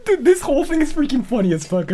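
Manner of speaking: high, laughing near tears. Real, not acted. Cracking up about the whole situation. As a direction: squeak it out through laughter.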